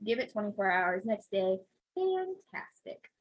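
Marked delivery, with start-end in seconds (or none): 1.9-3.1 sarcastic. Real, not acted. Sarcastic report of pain.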